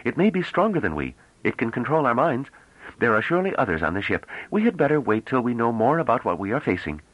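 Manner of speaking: high-pitched cautious companion; anxious scholar. Acted. Scholar warns companion to wait, anxious high voice. Character voice of Pellerat.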